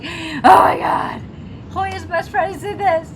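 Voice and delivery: high, drawn-out starstruck squeal; fangirl freak-out. Retold or imitated. Fan spots the idol in a drama, freaking out. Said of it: "and I freaked out".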